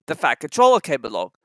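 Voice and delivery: ordinary delivery, nothing marked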